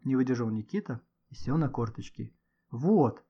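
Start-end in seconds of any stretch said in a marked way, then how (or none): 2.7-3.2 mildly raised voice; an understated narrated shout; excited cry. A narrator's reading. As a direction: point it out insistently. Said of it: "кричал Гошка".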